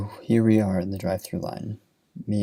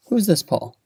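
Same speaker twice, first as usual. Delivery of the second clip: sharp, high and louder, jumping up on who's; a jealous wife catching on, voice leaping; suspicious wife; 10 semitones higher, 4 dB louder than usual. Retold or imitated. Jealous wife demands who Paul is.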